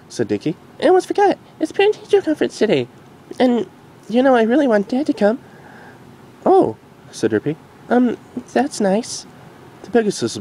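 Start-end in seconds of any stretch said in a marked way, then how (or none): 0.8-5.4 very high filly voice; curious filly. Acted. Dinky asking her mom, curious filly. 6.5-9.3 high, very slow awkward reply. Acted. Derpy uneasy about the conference.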